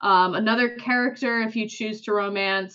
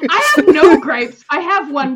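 loud protest; 5 semitones higher, 11 dB louder than usual. Real, not acted. Protesting co-host's teasing loudly.

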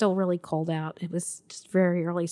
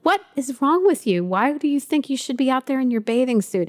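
exasperated scolding; 6 semitones higher than usual. Retold or imitated. Mother scolding upset child.

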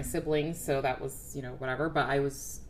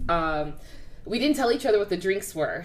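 ordinary talk

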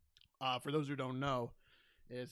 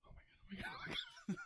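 mock panicked, voiceless gasping repetition; imitating scared comic; 6 dB quieter, much faster than usual. Mimicking the comic dreading his own joke, mock terrified. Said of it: "he was scared to even say this".

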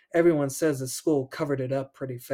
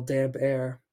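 usual speaking voice

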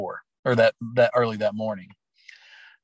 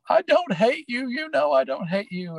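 much higher, imitating a crying teen pleading; tearful girl; 13 semitones higher than usual. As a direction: plead through tears, like the sobbing player hugging her coach. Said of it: "she just goes".

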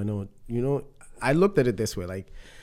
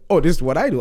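excited; 5 semitones higher, 12 dB louder, faster than usual. Retold or imitated. Excited retold reply.

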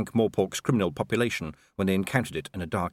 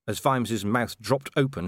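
ordinary delivery

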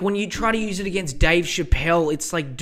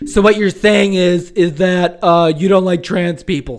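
louder, put-on voice of a smug critic; mock accusing critic; 7 dB louder than usual. Mocking critics of the comedian, put-on smug gotcha. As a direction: ham up a self-righteous gotcha. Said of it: "fucking idiots out there being like".

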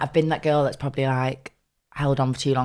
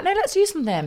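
eager suggestion. Retold or imitated. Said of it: "I'd be like".

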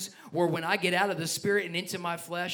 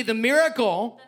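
loud declaration for the church to echo; call-and-response lead; 5 dB louder than usual. Real, not acted. Pastor has church say this with him, leading declaration. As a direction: proclaim it in a short, loud phrase. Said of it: "I want you to say this with me".

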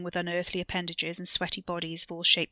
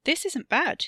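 pleasantly surprised; 9 semitones higher, 5 dB louder than usual. Acted. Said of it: "pleasantly surprised".

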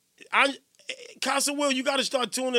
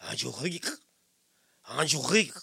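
low French Angelique with a throaty R; soft, low mock-French name; French pronunciation; 7 semitones lower, 4 dB quieter, faster than usual. Shown as a demonstration. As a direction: exaggerate the French R in Angelique, tune rising. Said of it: "She said it's French pronunciation".

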